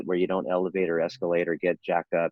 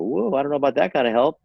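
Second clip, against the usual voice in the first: higher, louder pushback voice, startled; resisting family member; 7 semitones higher, 5 dB louder than usual. Retold or imitated. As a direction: balk at the idea, voice jumping up. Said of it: "And I go".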